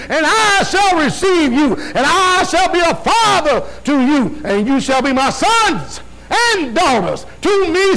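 booming fervent sermon reading; preaching at full force. Acted. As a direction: thunder the verse from the pulpit. Shouted scripture reading.

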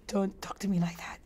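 a breathy whispered replay of mom's scolding; whispered mother line. Shown as a demonstration. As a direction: whisper it, no voice at all. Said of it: "that is exactly what my mother would do".

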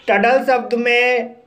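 ordinary talk

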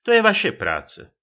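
high, loud reproach; annoyed. A narrator's reading. Lieutenant blaming Čambal for the trouble, annoyed. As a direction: throw it out high and indignant. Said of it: "zlobil se nadporučík Kříž".

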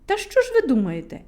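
ordinary delivery

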